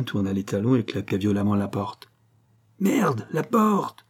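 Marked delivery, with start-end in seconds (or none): 2.8-4.1 much higher, dragged-out angry yell. A narrator's reading. Victor yells after the slammed door, angry bellow. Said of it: "gueula Victor".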